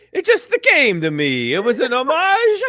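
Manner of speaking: mock-innocent quote, swooping up high and loud; Baker's quoted response. Co-host quoting Baker after the game, in hammed-up innocence. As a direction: play up the last word with a laugh. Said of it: "Baker's response was".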